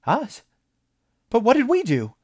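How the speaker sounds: a nervous man begging for answers; alarmed high questions. A narrator's reading. Moss alarmed.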